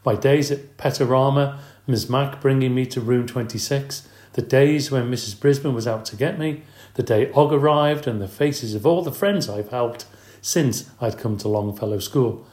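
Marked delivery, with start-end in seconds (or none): none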